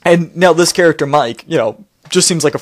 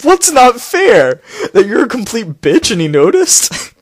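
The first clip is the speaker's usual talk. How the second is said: sarcastic retort; 6 semitones higher, slower than usual. Real, not acted.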